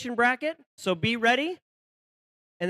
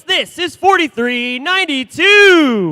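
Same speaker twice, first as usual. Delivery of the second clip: arena announcer; 3 semitones higher, 12 dB louder, slower than usual. Acted. Announcing team numbers.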